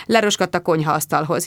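usual speaking voice

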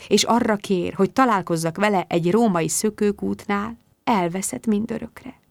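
usual speaking voice

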